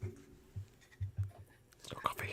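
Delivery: slow, breathless whispered aside; co-host whispering that his mug holds beer. Real, not acted. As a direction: whisper it like a secret. Sneaky reply about drinking.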